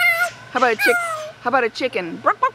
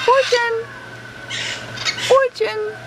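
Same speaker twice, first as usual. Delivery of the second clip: name called in a high-pitched voice; high, piping parrot-training voice; prompting a bird; 13 semitones higher, slower than usual. Shown as a demonstration. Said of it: "Can you say Fortune?".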